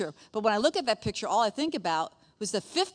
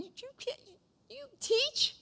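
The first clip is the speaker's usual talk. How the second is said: very high, incredulous squeak; mocking taunt; slower than usual. The enemy doubting she could teach, mocking. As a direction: sneer in a tiny high voice.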